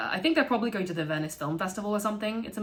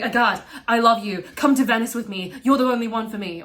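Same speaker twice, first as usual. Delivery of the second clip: loud pleading; 4 semitones higher, 8 dB louder than usual. Retold or imitated. Thomas love bombing in class.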